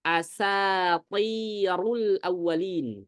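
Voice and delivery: Asatirul awwalin slow, heavy emphatic sa; recitation demo. Shown as a demonstration. Ustadz demonstrating the verse to students, slow recitation. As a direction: draw out Asatirul awwalin carefully. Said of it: "qala asatirul awwalin".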